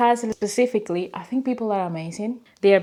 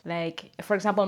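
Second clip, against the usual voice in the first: usual speaking voice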